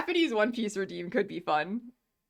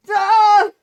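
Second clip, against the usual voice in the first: sharp loud startled yelp; startled scream; 13 semitones higher, 15 dB louder than usual. Acted. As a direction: cry out in sudden shock. Character cries out in shock.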